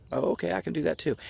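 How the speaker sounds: high upbeat can-do voice; bright pep to yourself; cheerful self-talk. Pretend. Voicing a motivated self.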